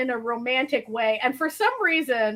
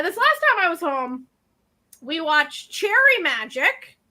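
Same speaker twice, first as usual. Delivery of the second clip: ordinary talk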